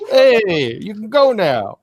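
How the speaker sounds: hammy New York tough guy; New York accent. Pretend. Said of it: "was it in a thick New York accent?".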